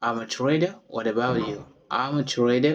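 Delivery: ordinary delivery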